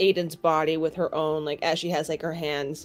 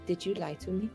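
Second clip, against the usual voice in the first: hushed, much softer accusation; dark and firm; 10 dB quieter than usual. Acted. Aosia confronting Aiden, quiet dark question. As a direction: drop to a quiet, firm demand. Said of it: "dark, firm expression on her face".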